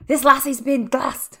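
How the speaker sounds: hammed-up Scottish impression, drawing out the words; attempted Scottish accent. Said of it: "trying to do the accent".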